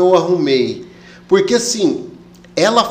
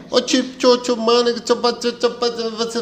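high-pitched babbling parody of Pará speech; rattled-off Pará accent send-up; mock Pará accent; 5 semitones higher, slower than usual. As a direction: imitate an unintelligible Pará accent. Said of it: "um sotaque bem peculiar".

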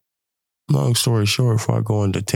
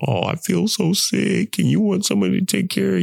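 mocking whine; 9 semitones higher than usual. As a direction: whine it with a wink. Mocking self-pity when sick.

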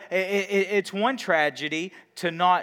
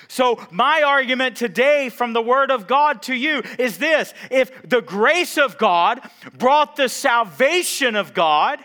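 loud, raised, emphatic sermon delivery; full-force preaching; 8 semitones higher, 8 dB louder than usual. Real, not acted. Preacher making today's argument from the Word of God, full-force preaching. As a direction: press the argument home loudly.

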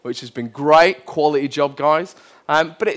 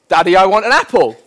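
louder, quicker imitation of a small child; child repeating a request; 4 semitones higher, 8 dB louder, faster than usual. Son repeats his apple request to dad, louder imitated child. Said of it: "And so he repeats".

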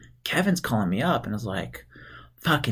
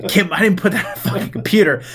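laughing through quote; 8 semitones higher, 3 dB louder than usual. Retold or imitated. Laughing while quoting Kevin.

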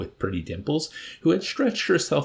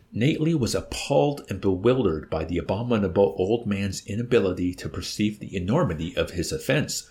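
ordinary delivery

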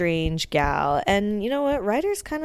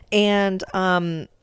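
usual speaking voice

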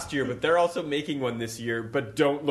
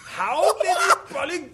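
high, loud mock German accent, breaking off; badly faked German accent through laughter; botched German accent; 13 semitones higher, 4 dB louder than usual. Friend has a go at the joke question, botched German accent. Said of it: "that's not even the right accent".

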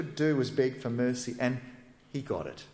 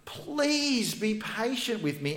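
higher, slow pleading; begging; 9 semitones higher, slower than usual. Acted. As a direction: beg him, drawn out and high. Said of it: "and begged him".

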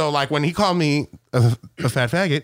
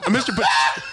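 ordinary talk, nothing marked

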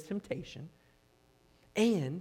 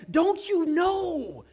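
much higher, louder and slowed, pleading in anger; frustrated shout; 12 semitones higher, 8 dB louder, much slower than usual. Retold or imitated. James scolding believers, slow exasperated shout. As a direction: drag out "Don't you know?" in exasperation. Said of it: "I think he's shouting".

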